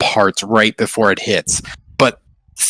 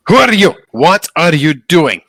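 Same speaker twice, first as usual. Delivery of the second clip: stern demand; 5 semitones higher, 5 dB louder than usual. Acted. Mage confronts player at crossbow point.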